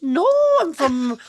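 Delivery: very high, drawn-out mock Scottish nanny voice; Mrs Doubtfire Scottish. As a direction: stretch it out like a prim old Scottish nanny. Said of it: "my Scottish accent is so much more akin to Missus Doubtfire".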